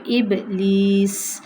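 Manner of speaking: ابلیس very slow with a long hissed seen at the stop; whistling seen. Shown as a demonstration. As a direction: end ابلیس on a held whistling s. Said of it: "سین سیٹی والا ہوتا ہے".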